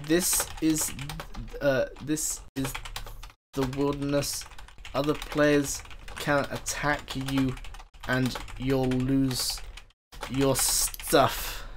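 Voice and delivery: soft, slow, halting explanation; slow explanation to newbie. Acted. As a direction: spell it out slowly, with long pauses. Explains wilderness to lost noob.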